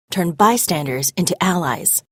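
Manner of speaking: ordinary delivery, nothing marked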